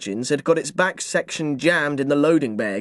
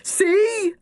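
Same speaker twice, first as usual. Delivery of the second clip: put-on boy crowing over his point. A narrator's reading. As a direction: gloat in victory. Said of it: "I crowed".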